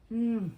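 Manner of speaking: loud pleased hum; loud yum. Real, not acted. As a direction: hum loudly at the taste. Tasting food.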